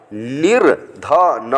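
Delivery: निर्धन drawn out slowly, nirdhan; slow demonstration. Shown as a demonstration. Teacher slowly models the word for students, nirdhan. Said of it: "देखिए सब मिलाते जा रहे हैं".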